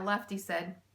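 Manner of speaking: ordinary delivery, nothing marked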